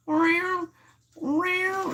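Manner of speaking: very high squeaky imitated meowing; mock cat meow. Imitating the cats meowing behind Gary, very high squeaky meow.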